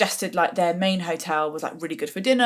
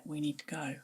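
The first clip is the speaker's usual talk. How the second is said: low hushed voice, quietly pressing; hushed urgent; 3 semitones lower, 14 dB quieter than usual. Retold or imitated. Husband quietly urging his wife to leave the restaurant, hushed. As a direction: keep it hushed so nobody overhears.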